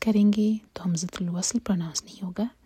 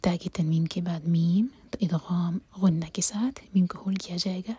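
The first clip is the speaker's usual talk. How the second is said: fast whispered teacher's aside; whispering. Real, not acted. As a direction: whisper the rule quickly. Whispered tajweed explanation.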